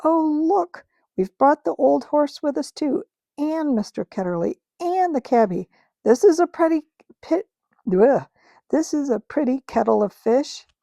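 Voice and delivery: put-on a girl noticing who came along; excited girlish exclamation; excited cry. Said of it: "Polly cried out".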